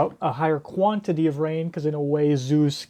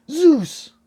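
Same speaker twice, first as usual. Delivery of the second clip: high, loud shout of the name; alarmed cry; 12 semitones higher, 6 dB louder than usual. Acted. Nami seeing Zeus swallowed whole, alarmed cry.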